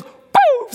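sudden high "Boom!" sound effect; mock boom. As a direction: shout a playful vanishing boom. Jesus vanishing, sound effect.

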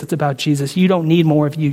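ordinary talk, nothing marked

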